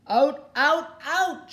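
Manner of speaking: high, loud pirate bellow; a pirate ordering the teacher out; pirate shout. Acted. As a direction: roar it at the stubborn teacher. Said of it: "shouted Pirate Ten".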